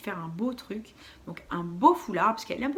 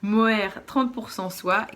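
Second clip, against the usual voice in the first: ordinary delivery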